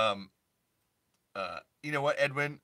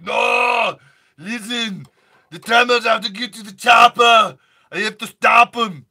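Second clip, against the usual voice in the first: high, loud action-hero yell; over-the-top action hero shouting orders; heroic movie shout; 10 semitones higher, 15 dB louder than usual. Acted. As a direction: bellow it like a movie hero in a crisis.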